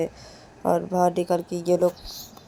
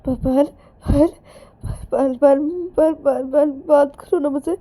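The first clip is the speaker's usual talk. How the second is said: high, loud, stuttering panic; sobbing, breaking pleas; frantic crying; 7 semitones higher, 6 dB louder than usual. Acted. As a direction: call her name in desperate tears. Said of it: "Ananya रोते रोते".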